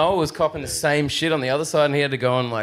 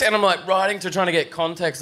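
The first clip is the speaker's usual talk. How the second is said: ordinary talk, nothing marked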